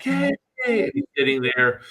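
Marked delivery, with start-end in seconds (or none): 0.0-0.8 high mock crowd cheer; thin, high-pitched imitation of cheering; cheering audience. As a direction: imitate a studio audience applauding a guest. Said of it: "And everyone's like".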